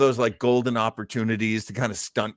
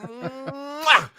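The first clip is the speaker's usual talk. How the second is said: kissing smack; 13 semitones higher than usual. Real, not acted. Loves the joke poem.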